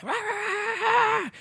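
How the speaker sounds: high-pitched mock barking; rapid, high dog-bark imitation; imitated dog barking. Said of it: "just barking".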